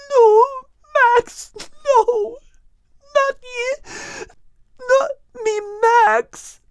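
very high, sobbing plea; crying out. Acted. Kate crying over her dying companion Max, high sobbing wail. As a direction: wail his name through tears. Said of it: "crying out his name as tears streamed".

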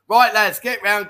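hammed-up call to his mates once she's gone; calling the lads in. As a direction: shout the lads over, gleeful. Husband calls mates in once wife leaves.

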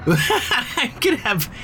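laughing through "I'm gonna have", slow; relieved laughter. Acted. Picturing life free of family politics: laughing relief. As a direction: giggle out the words in giddy relief.

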